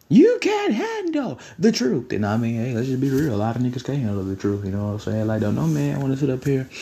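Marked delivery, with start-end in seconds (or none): 0.1-2.1 high, slow mock-outraged cry; dramatic movie quote. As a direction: draw it out like a famous courtroom outburst. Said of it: "What they used to say back in the day".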